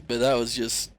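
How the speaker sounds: usual speaking voice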